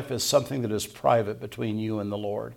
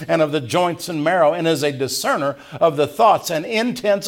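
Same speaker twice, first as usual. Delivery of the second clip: louder, higher preaching voice building to a peak; full-force preaching; 5 semitones higher, 5 dB louder than usual. Acted. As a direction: preach it to the rafters.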